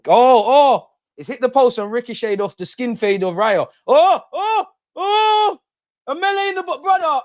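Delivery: high, loud, frantic goalmouth yelling; real fan yelling at a melee; excited shout. Real, not acted. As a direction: scream through the scramble in the box. Ball hits the post, chaos.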